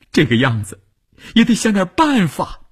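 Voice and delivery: high-pitched insistence; urgent pleading. Acted. Wife presses husband to find some way, urgent and high. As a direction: push back, voice climbing in distress.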